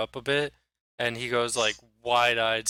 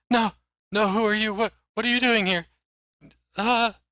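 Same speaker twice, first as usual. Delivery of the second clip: high, loud, startled stammering; panicked outburst; 10 semitones higher, 6 dB louder than usual. Acted. Cowering mayor confronted by strangers, loud high-pitched panic. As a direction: recoil in alarm from intruders.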